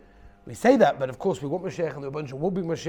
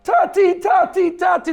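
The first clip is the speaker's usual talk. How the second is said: very high, loud wailing calls for dad; child screaming; 13 dB louder than usual. A narrator's reading. Fallen child screaming for his father, very high and loud. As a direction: cry out for daddy at full volume. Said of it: "the child is screaming and crying".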